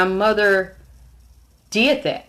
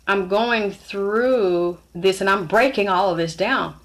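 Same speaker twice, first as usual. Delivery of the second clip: ordinary talk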